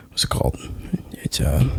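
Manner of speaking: whispering while searching for a word; whispered. Real, not acted. Guest gropes for the word phone, whispering. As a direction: hush down while hunting the word.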